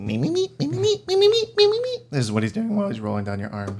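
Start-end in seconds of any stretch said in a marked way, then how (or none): 0.0-2.0 very high, rapid squeaky chant; tiny creature squealing with glee; excited critter squeak. Acted. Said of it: "He's very excited".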